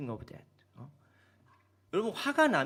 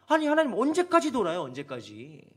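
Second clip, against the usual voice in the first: angry complaint; 3 semitones higher, 5 dB louder than usual. Retold or imitated. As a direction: shout the protest at God. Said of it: "이렇게 화가 나는 거예요". (하나님께 화내며 따지는 목소리)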